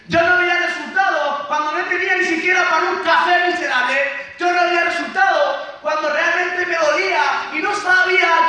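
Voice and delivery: high, loud, impassioned shouting; preaching shout. Real, not acted. Motivational speaker shouting his story to followers, impassioned. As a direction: preach it at full force to the crowd.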